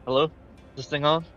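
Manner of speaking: ordinary talk, nothing marked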